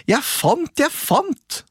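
high, eager sing-song call; a boy crowing over his find. A narrator's reading. Askeladden calling to his brothers, high excited cry. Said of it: "ropte han".

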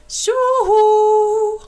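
high, loud sing-song hollering; calling out. A narrator's reading. Jula hollering to the children as he gallops in, high singing call. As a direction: whoop it across the ice. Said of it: "ropade Jula".

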